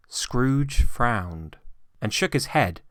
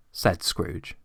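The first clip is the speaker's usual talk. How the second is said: ordinary delivery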